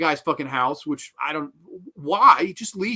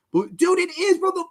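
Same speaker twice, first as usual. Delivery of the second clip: very high, incredulous wail; losing it over something absurd; exasperated outburst; 13 semitones higher, 6 dB louder, slower than usual. Real, not acted. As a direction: cry out in disbelief, rising to a loud squeal. Relapses into outrage about the floor.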